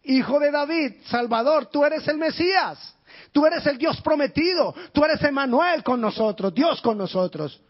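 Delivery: high, loud fervent calls; crying out. Acted. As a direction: shout each title with rising faith. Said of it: "él estaba diciendo".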